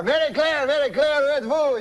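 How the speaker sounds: high-pitched calling in French; Jean-Luc's French-Canadian accent. Acted. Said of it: "Runs in the door".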